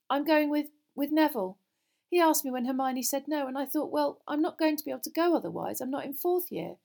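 high, loud, halting confession; awkward girl owning up; embarrassed girl. Acted. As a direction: explain yourself, flustered and defensive. Narrator voices embarrassed girl confessing.

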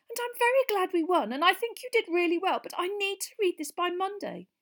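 high, strained voice; strangely high-pitched. A narrator's reading. Hermione answering Harry, strained high voice. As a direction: keep it oddly high, not looking up. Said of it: "in a strangely high-pitched voice".

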